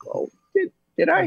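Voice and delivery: very high-pitched, tentative "oh, really?"; feigned surprise. Retold or imitated. Actor answering a fan who mistook his accent, feigned surprise. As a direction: play politely taken aback, voice way up high. Polite reply to mistaken fan.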